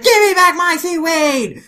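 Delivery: very high, loud yell; shrieked demand. Acted. Kagura demanding seaweed while kicking Gin, shrieking. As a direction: screech it as an acted tantrum.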